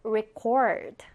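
"record" with the last syllable stressed, re-CORD; stress on second syllable. Shown as a demonstration. Teacher models the verb for students, stress on second syllable of "record". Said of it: "디귿절에 강세를 넣었죠".

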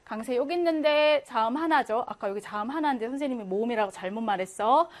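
ordinary talk

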